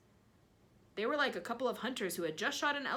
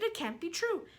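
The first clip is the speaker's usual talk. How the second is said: excited; 14 semitones higher, 7 dB louder than usual. Acted. Aunt frantic at miracle.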